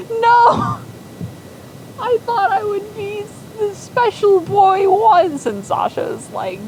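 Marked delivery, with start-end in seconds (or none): 0.1-5.5 very high, slow hammed-up wail; wailing despair. Zug collapsing because no baby is named after him, hammed-up wail. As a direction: wail dramatically from your knees. Zug falls to his knees.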